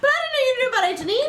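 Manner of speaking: very high, rapid squeal; amazed. Real, not acted. Amazed he knows about ancient Egypt, astonished. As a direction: gush quickly in high-pitched disbelief.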